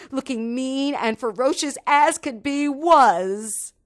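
dramatic build. A narrator's reading.

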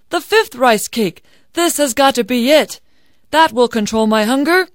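determined hungry man. Acted. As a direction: insist loudly, desperate to end the hunger. Hungry man insists this will suffice.

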